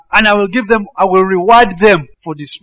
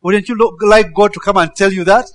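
normal talking voice

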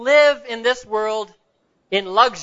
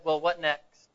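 put-on low, soft prompt from an old pastor; lightly voiced old pastor, lower and softer; 5 semitones lower, 7 dB quieter, slower than usual. Old pastor questioning a graduating boy, lightly voiced, low and soft. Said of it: "The old pastor said".

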